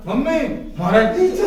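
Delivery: mock a child showing off his teacher; mocking a kid. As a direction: kid shouting to mom, loud and high. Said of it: "अरे, ऐसे देते हैं teacher". (बच्चे की नकल, ज़ोर से)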